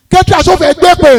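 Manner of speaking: loud, high mock lament; hammed-up wailing. Comedian mocking poor mourners crying over lost drink. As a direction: howl over the spilled drink.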